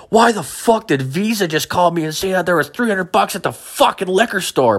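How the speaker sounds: high-pitched, strained angry rant; irate dad. Acted. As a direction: play an enraged father demanding answers. Acted furious dad on phone.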